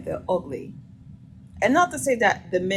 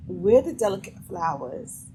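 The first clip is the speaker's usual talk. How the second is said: ordinary talk, nothing marked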